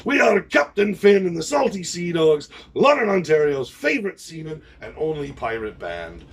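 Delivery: loud showman intro of the band; stage announcement. Real, not acted. Pirate captain introducing his band, proud and loud.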